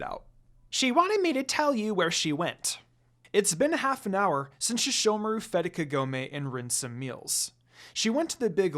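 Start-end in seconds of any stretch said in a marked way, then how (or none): 0.7-2.8 high eager little servant voice; lightly voiced high imp; squeaky servant imp. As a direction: chirp it up to the lord. Jokin voice.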